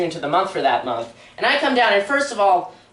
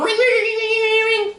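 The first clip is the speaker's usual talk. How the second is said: high, rapid trilling bell imitation; ringing school bell; 14 semitones higher than usual. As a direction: imitate a shrill old-fashioned school bell. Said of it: "we hear a ring".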